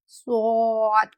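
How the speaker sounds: स्वाद very slow, thick and heavy; heavy swad. Shown as a demonstration. Teacher models the heavy letter for practice, slow and heavy. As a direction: draw out स्वाद heavily. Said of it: "heavy sound के साथ अदा करना है".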